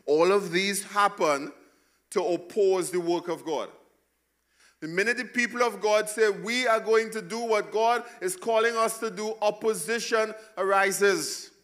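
climbing, loud pulpit delivery; full-force preaching. Acted. Pastor rousing the congregation, preaching at full force.

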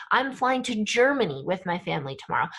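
"Germany" punched out, the rest quick; emphasize the word "Germany" with the voice; quick sentence leaning hard on "Germany"; stress on Germany. Shown as a demonstration. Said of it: "we would use our voice".

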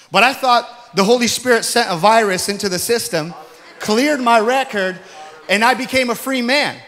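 loud, high testimony build; fervent preacher's climax; preaching at full force. Acted. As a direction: proclaim the freedom to the congregation.

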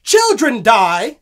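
loud, slow doom list; dramatic announcement. Acted. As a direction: toll each one out grimly. Dramatic storyteller proclamation.

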